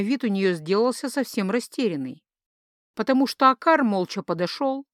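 usual speaking voice

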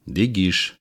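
ordinary talk, nothing marked

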